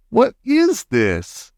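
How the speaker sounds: put-on slow uneasy boy; Bruno asking. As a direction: drag out the boy's question. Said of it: "he said".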